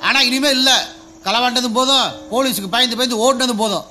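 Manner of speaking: heated retort. Acted. Husband firing back at his wife's taunt, high and heated. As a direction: fire it back at her, pitched high.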